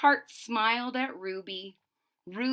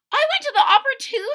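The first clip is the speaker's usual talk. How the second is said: much higher, louder, rushed; eager boast; 9 dB louder, much faster than usual. Acted. Little Ruby eagerly telling her teacher, excited and fast. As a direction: blurt it out in a bright rush.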